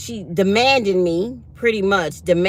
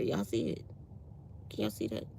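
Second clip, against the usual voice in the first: hushed aside; 10 semitones lower, 15 dB quieter than usual. Real, not acted. Showing a sweater ball.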